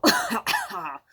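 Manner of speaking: real sudden cough. Real, not acted. Chip caught in throat, real cough. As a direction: cough mid-word. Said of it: "one went down the wrong way".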